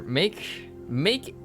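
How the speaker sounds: ordinary delivery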